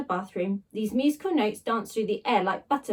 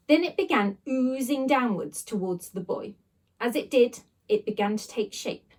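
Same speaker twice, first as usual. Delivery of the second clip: usual speaking voice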